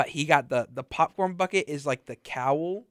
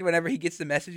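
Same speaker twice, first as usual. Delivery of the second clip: ordinary delivery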